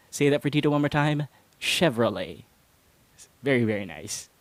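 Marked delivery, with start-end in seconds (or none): 1.6-2.4 "Chevrolet" as SHEV-ro-lay, lower and falling; correct pronunciation. Shown as a demonstration. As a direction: model "Chevrolet" with SH at the start and a V. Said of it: "start with an S H sound".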